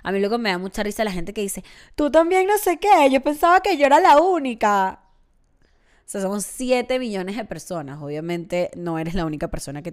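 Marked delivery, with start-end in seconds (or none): 2.0-5.0 a higher, louder whiny mock voice; mocking imitation. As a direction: parody someone who thinks they're unique. Mocking people who say this.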